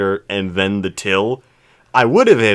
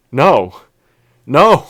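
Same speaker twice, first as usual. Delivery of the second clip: loud, higher, insistent no; loud mock protest; 7 semitones higher, 8 dB louder, slower than usual. Cashier refusing the overpayment, slipping into loud Japanese protest. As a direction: wave the money back.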